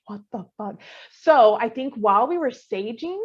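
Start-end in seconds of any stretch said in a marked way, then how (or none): 0.1-0.8 hushed, much softer shocked question; barely breathing it out in fear; frozen whisper of disbelief; stunned hush. Retold or imitated. Stunned after seeing shadow.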